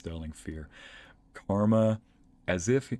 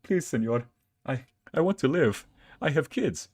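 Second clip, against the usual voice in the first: higher, louder hammed-up pleading for life; mock begging; 5 semitones higher, 4 dB louder than usual. Dog begs owner to spare him, in hammed-up pleading. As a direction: beg for mercy, hammed up.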